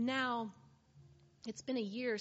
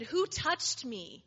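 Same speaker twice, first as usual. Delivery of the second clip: slow, big and loud, a commanding call; booming voice; 3 semitones higher, 7 dB louder, slower than usual. Acted. Jesus turning to the crowd, demanding who touched him, booming. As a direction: boom it out over the crowd. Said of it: "with a booming voice".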